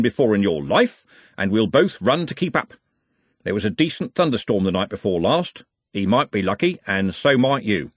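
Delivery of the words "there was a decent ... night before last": ordinary delivery, nothing marked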